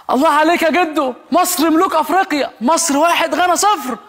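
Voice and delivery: high, cheering like a proud fan; mock football cheer. Fan cheering grandpa over Egypt's win, high and excited.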